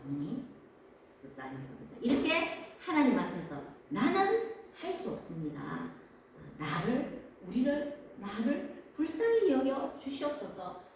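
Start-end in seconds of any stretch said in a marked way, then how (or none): 3.9-10.8 raised, slow pleading; humble plea. Acted. Believer lowering self before God, humble plea. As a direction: plead earnestly, drawing out the words.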